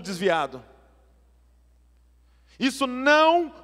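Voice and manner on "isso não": high, loud, slow preaching; preaching at full force. Real, not acted. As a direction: drive the point home to the church. Preaching climax, raised voice. (pregação inflamada)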